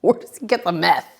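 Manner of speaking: ordinary talk, nothing marked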